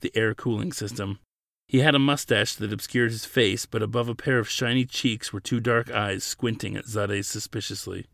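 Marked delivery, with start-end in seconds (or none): none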